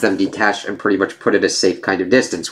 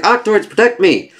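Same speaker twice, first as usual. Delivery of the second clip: high-pitched mock cry for protection; urgent plea; 12 semitones higher than usual. Otto calling his Octoids to protect him, urgent high plea. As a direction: call out for help, hammed up a little.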